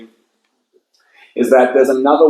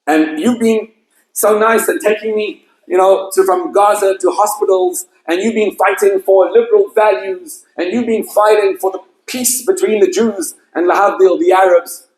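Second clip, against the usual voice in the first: higher, taunting voice listing the favours; mock terrorist; 9 semitones higher than usual. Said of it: "and he says".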